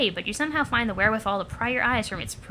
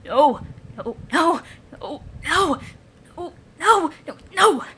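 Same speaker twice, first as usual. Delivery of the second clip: rising, louder string of refusals; panicked denial; 3 semitones higher, 3 dB louder, slower than usual. Acted. Fused creature refusing what it has become, panicking. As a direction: build from dismay to high, loud panic.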